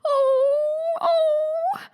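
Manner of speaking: high, wailing oh oh; a hammed-up wail; wailing queen. Queen wails about her brooch, hammed-up high wail.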